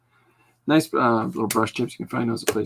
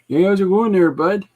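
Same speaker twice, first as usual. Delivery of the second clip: hammed-up backwoods Canadian greeting; 10 semitones higher, 9 dB louder than usual. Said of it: "Northern hicks say".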